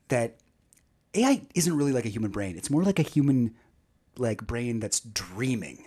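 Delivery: ordinary delivery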